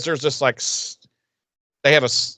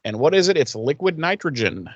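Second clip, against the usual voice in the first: usual speaking voice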